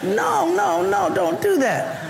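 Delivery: high, pleading whine, a little slow; high frustrated plea. Retold or imitated. Driver stuck behind slow car, whining high and pleading. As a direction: beg the slow driver to stop. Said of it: "It's just like".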